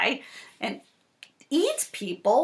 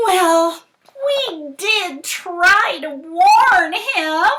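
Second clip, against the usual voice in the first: higher, louder, slow chick voice; slow high told-you-so; chicks' told-you-so; 7 semitones higher, 6 dB louder, much slower than usual. Acted. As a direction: drawl it out like smug little chicks. Chicks' smug line.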